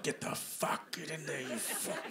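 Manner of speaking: hushed, squeezed-out struggling curse; mock straining effort. Comic forcing bun into toaster, mock strained effort. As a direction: strain as if shoving something stuck.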